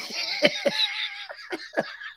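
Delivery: long, high-pitched wheezing laugh; drawn-out squealing laughter; real laughing fit. Real, not acted. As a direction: crack up helplessly at your own joke. Cracks up at his own joke.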